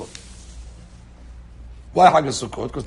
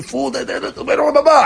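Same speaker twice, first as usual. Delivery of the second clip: higher, chiding fool call; mock scolding crowd; 10 semitones higher, 4 dB louder than usual. Everybody mocking the news junkie, higher scolding. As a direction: scold him as a fool, pitched up. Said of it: "And everybody tell you".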